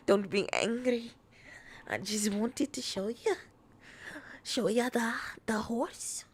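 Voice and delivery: lightly put-on Scottish lilt, a wee lass; playful fake Scottish accent; mock Scottish accent. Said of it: "with that accent".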